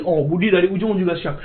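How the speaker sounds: plain reading voice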